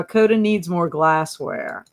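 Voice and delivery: ordinary talk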